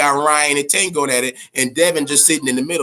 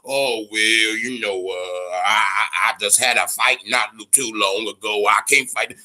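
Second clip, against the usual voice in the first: lower, mumbling mock excuse voice; mocking whiny fighter; 8 semitones lower than usual. Mocking boxers who claim they need rest, lower and whiny. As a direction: imitate a fighter weakly making excuses. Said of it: "I don't wanna hear no bullshit talk about".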